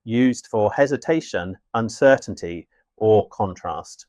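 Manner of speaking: normal talking voice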